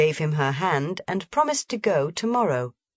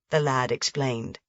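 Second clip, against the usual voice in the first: plain reading voice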